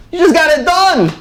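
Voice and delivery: normal talking voice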